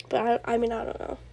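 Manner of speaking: ordinary talk, nothing marked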